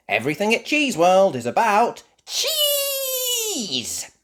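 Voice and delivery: very high, slow, laughing and cheering; gleeful guide. Acted. Miss Rabbit introduces cheese theme.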